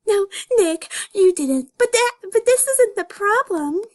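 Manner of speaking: bunny correcting her partner, upset. Acted. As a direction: plead that he's missed the point. Voicing Judy.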